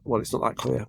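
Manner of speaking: usual speaking voice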